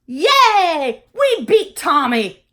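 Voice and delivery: triumphant cheer. Pretend. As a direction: celebrate the win. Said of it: "go to bed at night like I do".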